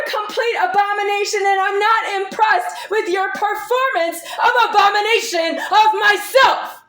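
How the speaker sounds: high, loud enraged yelling; furious shouting. Real, not acted. Shouting at the man who accused her, enraged. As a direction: scream every line at him.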